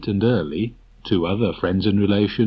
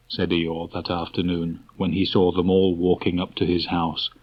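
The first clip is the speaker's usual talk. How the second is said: ordinary delivery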